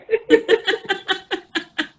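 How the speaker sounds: high-pitched giggling laugh; long, high giggle; real laugh. Real, not acted. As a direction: laugh freely at your own joke. Laughing at own happy-hour joke.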